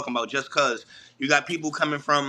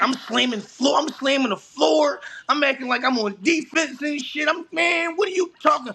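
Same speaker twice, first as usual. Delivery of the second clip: fired-up, much higher-pitched boasting; K G on defense; 11 semitones higher than usual. Retold or imitated. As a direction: act out hyped trash talk from the court. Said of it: "I'm back there acting like K G".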